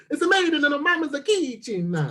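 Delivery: high, hammed-up Italian accent; sing-song mock Italian; Italian impression. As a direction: proudly show off a cartoonish Italian accent. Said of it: "magnificent Italian impression".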